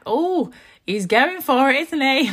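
drawn-out knowing mum tone; imitating another mum. Mum quoting another mum at the pool, slow and sympathetic. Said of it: "she was like".

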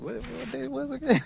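high, puzzled "what day was it"; sudden confused squeak; surprised. Retold or imitated. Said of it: "I was like".

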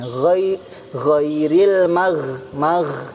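drawn-out ghairil magh, closed with held gh; dragged gh. Shown as a demonstration. Teacher modelling the right way, dragged gh in ghairil magh. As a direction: let magh fade on the gargle, no added vowel. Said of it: "cukup diseret aja suaranya".

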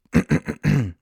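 staged throat-clearing before a recital. A narrator's reading. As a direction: clear your throat like a poet about to perform. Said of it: "Frederik räusperte sich".

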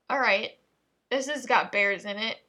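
normal talking voice

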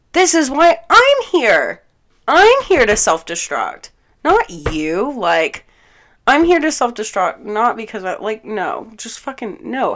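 high, loud, exasperated outburst; rage. Retold or imitated. Raging that he borrowed her excuse to self-destruct, exasperated. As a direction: vent in outrage, hammering the point then spluttering. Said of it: "five minutes of fucking rage".

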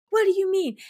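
high-pitched mock outrage; retold outraged disbelief. Woman confronting the other assassins, high mock outrage. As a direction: imitate an indignant, disbelieving demand. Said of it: "and she's like".

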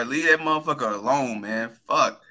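ordinary delivery

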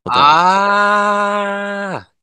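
long, high drawn-out ah; loud, stretched recognition; sudden realization. Real, not acted. Remembering the old letter.

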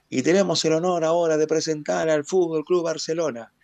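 stadium announcer. Retold or imitated. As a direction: call it out like the PA voice of a packed stadium. Stadium announcer presenting the team.

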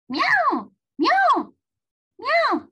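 loud, high-pitched cat cries; screamed meows. A narrator's reading. Calico waking sleeping owner, screamed meows. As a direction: yowl like a cat demanding attention. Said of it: "screamed Calico".

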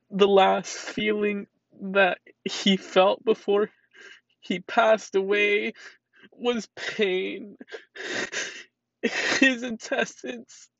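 crying hard, words dragged out through sobs; sobbing. Real, not acted. Owner describes her cat's painful death, sobbing.

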